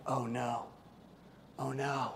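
soft, low oh no; mock quiet panic; hushed dread. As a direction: dread it under your breath. Dread at mom.

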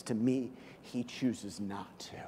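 slow soft whisper; whispered tenderness. Acted. Pastor whispering about Jesus' mercy, tender. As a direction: let it land gently.

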